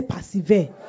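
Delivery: firmer call to repeat the word. Real, not acted. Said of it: "I can't hear you".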